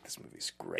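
hushed, voiceless whisper; retold whisper. Moviegoer leaning over to a friend, retold whisper. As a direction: whisper it low as an aside.

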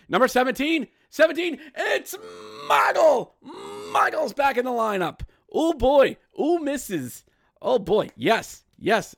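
very high, loud excitement; high, loud, overjoyed call-out; thrilled. Real, not acted. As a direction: shout with delight. Thrilled at player's return.

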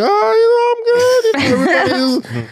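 very high-pitched mock small talk; flustered polite answer. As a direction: play along with a stranger's warm greeting, high. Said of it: "I'm just answering the questions like".

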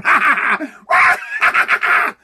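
loud, high mock cackle; maniacal laugh. Picturing the coworker's face in the dream, maniacal cackle. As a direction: imitate a nightmare villain's mad laughter. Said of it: "picturing his face, and he's going".

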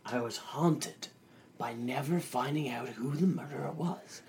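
ordinary talk

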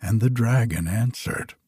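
ordinary delivery

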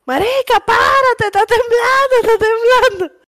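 waking friend during earthquake, panicked; mock panic. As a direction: urge your friend up in fright. Retelling her own earthquake panic.

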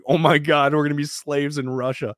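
high-pitched retold panic; mock alarm. Rescued rower fearing where the ship goes, mock panicked. Retelling his alarm at the destination.

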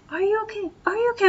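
high, mocking pestering "Are you okay?"; mock fussing friend. Friend hovering over the girl, mock worried high voice. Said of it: "sitting there going".

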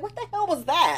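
high, loud incredulous quote; mock bafflement. [name] reacts to the awkward moment, mock bafflement.

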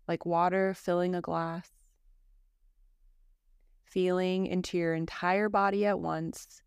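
calm, even yoga nidra guidance with long pauses; guided meditation. Acted. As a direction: guide a listener gently into rest, phrase by phrase.

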